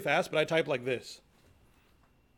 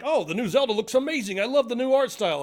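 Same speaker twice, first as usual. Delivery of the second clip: gushing friends; 8 semitones higher, 6 dB louder than usual. Retold or imitated. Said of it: "and they're like".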